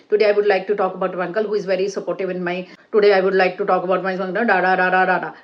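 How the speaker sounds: very fast, on one pitch with no breaks; droning, rushed recital without any intonation; monotone rattle. Shown as a demonstration. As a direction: race through it in a monotone, trailing into da-da-da. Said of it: "Don't speak like this".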